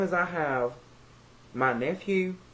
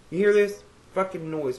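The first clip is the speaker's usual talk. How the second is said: louder and higher, fed up with the noise; exasperated outburst; 6 semitones higher, 6 dB louder than usual. Real, not acted. Ranting to viewers about neighborhood noise, loud exasperated call-out. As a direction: snap at the racket outside.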